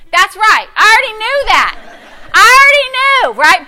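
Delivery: high, loud I-told-you-so; triumphant retort. Retold or imitated. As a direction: crow it back, sure of the victory. Triumphant reply to good report.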